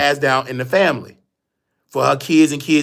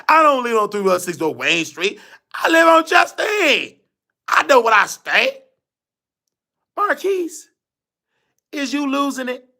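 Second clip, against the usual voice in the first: shouting indignant grandma; 11 semitones higher than usual. Retold or imitated. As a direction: yell it up high as the stubborn grandmother.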